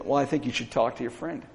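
low, soft and quick retold advice; understated, hushed counsel; quiet advice. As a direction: offer it quietly, almost as an aside. Retells his soft advice to caller.

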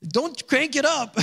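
high, drawn-out joking plea; mock protest. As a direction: keep it comically high and slow.

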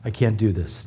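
a hushed, spent echo of the plea; fading plea. Retold or imitated. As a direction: let the repeat sink away quietly. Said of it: "we finally cry out to him and say".

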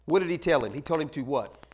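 ordinary delivery

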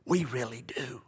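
secretive whispered insistence; hushed mock pleading. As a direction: lean in and whisper the claim. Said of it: "Just ask them".

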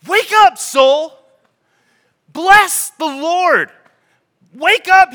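high, loud command to the soul; commanding shout. Acted. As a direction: rouse your own soul, call it awake. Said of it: "He's, he's commanding his soul".

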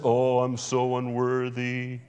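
slow, droning mock unworthiness; hammed-up "poor sinner" moaning; mock self-abasement. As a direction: drag it out in a woeful monotone. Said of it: "That doesn't mean we live in this".

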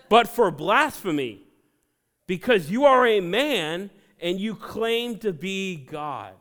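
loud, indignant crowd voice, slowing at the end; accusing mob shouting blasphemy; angry accusation. Acted. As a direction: hurl the charge at him.

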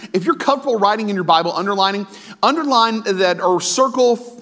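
ordinary delivery

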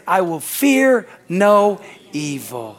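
very slow, word by word; deliberate emphasis. Real, not acted. Preacher completing the psalm for the congregation, slow and deliberate. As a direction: space out each word with weight.

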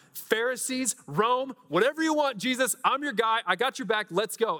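high, fired-up volley of loyalty; acted-out gung-ho follower; eager rallying. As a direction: pledge support like an eager sidekick. Imagines himself rallying for Jesus.

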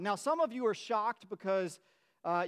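ordinary delivery

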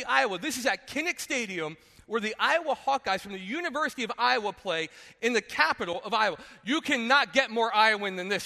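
ordinary delivery

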